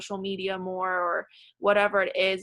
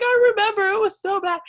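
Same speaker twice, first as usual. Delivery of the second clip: imitating her own crying, much higher and louder; mock tearful wail, pitched way up; retold bawling; 14 semitones higher, 8 dB louder than usual. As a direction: replay the tearful therapy moment in a high, choked-up pitch. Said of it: "I just started bawling".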